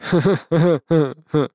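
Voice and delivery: awkward strained chuckle; forced laugh. A narrator's reading. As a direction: laugh at a bad joke uneasily. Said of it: "Он заставил себя рассмеяться".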